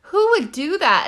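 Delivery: imitating partner. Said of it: "And Jill was like".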